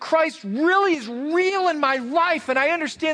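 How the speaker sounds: high, ringing preacher's voice, pushing each point; preaching at full force. Real, not acted. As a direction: preach it up, voice lifted high over the music.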